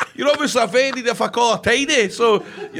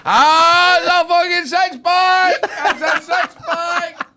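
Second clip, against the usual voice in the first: excited shouting; 9 semitones higher, 4 dB louder, much slower than usual. Real, not acted. Blindfolded guess, feels abs.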